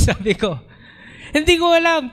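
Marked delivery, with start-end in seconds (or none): 1.3-2.1 high, teary imitation of the brother; crying brother. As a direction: let it crack like a man in tears. Brother crying, doesn't know why. (umiiyak, mataas na boses)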